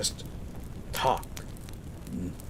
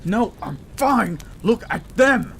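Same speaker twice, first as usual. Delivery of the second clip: high, loud and insistent; urgent brush-off; 11 semitones higher, 5 dB louder than usual. Acted. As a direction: wave the medics off toward your partner. Insisting rescuers help partner.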